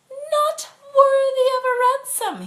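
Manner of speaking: high, loud teasing Irish lad; Flynn's teasing voice. Acted. The cab driver mocks his passenger, high and cocky. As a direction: tease her with a high, cocky lilt.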